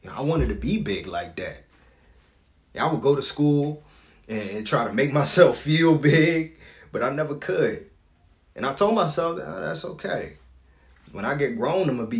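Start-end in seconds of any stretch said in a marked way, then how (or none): 2.8-9.4 loud, raised, insistent voice; impassioned recollection. Acted. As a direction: push it out, higher and louder. Recalls striving to feel big.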